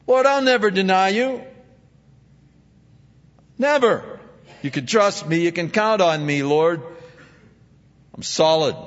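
boastful Peter. Retold or imitated. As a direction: proclaim it like an overconfident disciple.

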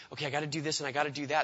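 rattled off fast like a hurried dad; busy dad. Retold or imitated. Preacher imitating a hurried dad ticking off chores, fast. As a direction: rush through the to-do list. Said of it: "he's like that dad".

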